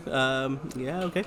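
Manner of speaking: ordinary delivery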